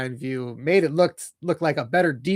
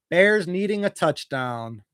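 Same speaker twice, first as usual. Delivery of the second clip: normal talking voice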